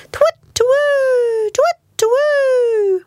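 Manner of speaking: very high, long owl hoots; hooting owl. A narrator's reading. Owl in the garden at night, high hooting. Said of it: "went the owl".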